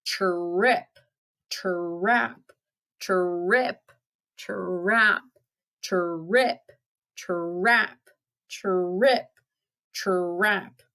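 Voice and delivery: drawn-out, rolling tr-rip, tr-rap; heavy goat footsteps. Acted. Big goat crossing the bridge, slow heavy trip-trap. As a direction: stretch each step, swinging up at the end. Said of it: "up came the big billy goat Gruff".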